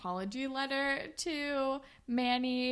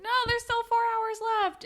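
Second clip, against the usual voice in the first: high, quick, louder imitation; mock panicked protest; 12 semitones higher, 5 dB louder, faster than usual. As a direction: squeal out a hurried objection in imitation. Voices Anya protesting to the crowd.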